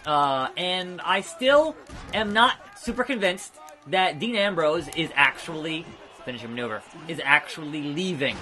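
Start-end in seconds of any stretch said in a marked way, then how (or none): none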